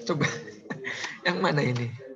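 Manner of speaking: ordinary talk, nothing marked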